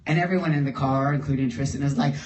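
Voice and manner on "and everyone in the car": ordinary talk, nothing marked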